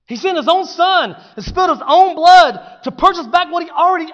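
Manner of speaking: loud, high impassioned preaching; preaching at full force. Real, not acted. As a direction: build and drive the point home. Sermon climax.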